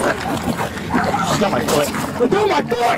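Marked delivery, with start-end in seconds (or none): 2.2-3.0 shouted surrender demands. Real, not acted. Officer's foot grabbed in struggle.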